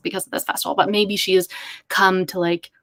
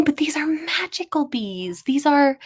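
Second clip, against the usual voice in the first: slow, higher, hushed "magical bees"; awed mystical tone; 7 semitones higher than usual. Pretend. Dramatic pitch about special bees.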